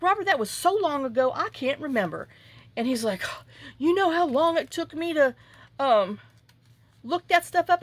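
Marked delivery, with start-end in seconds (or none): 0.0-2.3 exasperated retort. Retold or imitated. Retold argument with son. 3.8-7.8 higher, louder imitated whining son; whiny son. Son's retold complaint, voiced.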